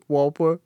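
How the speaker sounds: Whopper with a W sound in place of the R; says w for r. Shown as a demonstration. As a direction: say Whopper, the R turned into a W. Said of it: "I couldn't say my R's".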